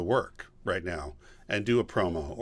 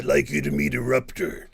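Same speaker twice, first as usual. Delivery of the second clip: lightly growled monster voice; a hint of a big lava creature; mild creature voice; Eruptor voice. Shown as a demonstration. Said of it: "he talks like this".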